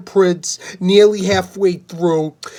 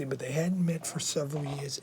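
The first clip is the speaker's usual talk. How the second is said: much softer, hushed retake of the line; quiet second reading of the sentence; 3 semitones lower, 16 dB quieter than usual. Acted. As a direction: read it again under your breath. Rereads same line much softer.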